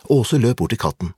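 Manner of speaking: usual speaking voice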